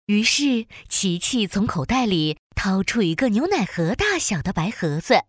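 ordinary delivery, nothing marked

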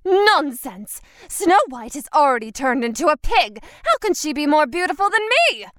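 fast, high indignant queen; angry queen. Acted. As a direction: snap back, furious and fast.

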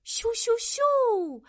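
high, slow, drawn-out whoosh; whooshing sound effect. Acted. Storyteller sending noodles flying, high drawn-out whoosh. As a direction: whoosh high, stretching the last one.

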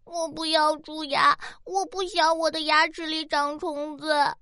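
put-on sobbing child pleading; crying. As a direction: wail it through tears. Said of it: "吓得哇哈哈大哭起来".

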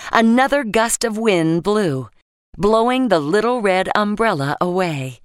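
ordinary delivery

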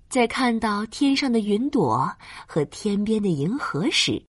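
usual speaking voice

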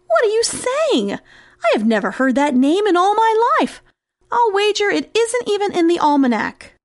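high-pitched, astonished protest; incredulous mouse. Acted. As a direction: protest in disbelief, voice jumping high. Mouse disbelieves the name.

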